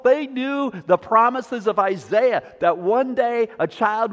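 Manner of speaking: full-voiced sermon delivery, rising and falling; preaching. Pastor to his congregation about the ten lepers, preaching.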